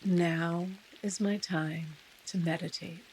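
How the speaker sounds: unhurried meditation guide with long pauses; guided meditation. Real, not acted. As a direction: ease listeners into stillness, softening as phrases end.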